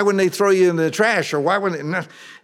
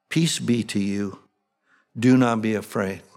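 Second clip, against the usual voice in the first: gentle angel-like reassurance; 7 semitones lower than usual. Acted. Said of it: "Sounds like what the angels would say".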